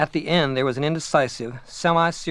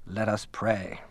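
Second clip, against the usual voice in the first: urbane murmur; 4 semitones lower, 6 dB quieter, slower than usual. A narrator's reading. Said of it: "in Mister Carhart's urbane murmur".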